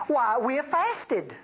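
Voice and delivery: mock a self-righteous churchgoer bragging of fasting; mock pious complainer. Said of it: "they say".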